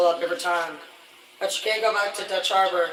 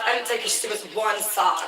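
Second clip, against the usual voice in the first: usual speaking voice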